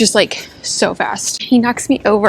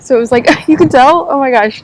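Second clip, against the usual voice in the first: laughing; 5 semitones higher, 8 dB louder than usual. Real, not acted. Laughing at herself.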